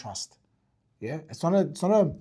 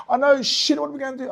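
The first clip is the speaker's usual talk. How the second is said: much higher, louder panicked echo; mock panic; 11 semitones higher, 10 dB louder than usual. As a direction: panic right along with her. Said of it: "I'm not gonna sit there and go".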